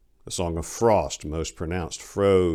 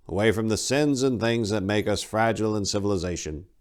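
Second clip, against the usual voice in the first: raised, solemn storytelling monk; old monk reciting; 4 semitones higher than usual. Acted. Old monk retelling the god's story to pass guard duty, solemn. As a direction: recite the creed like an old habit. Well ensconced in his verse.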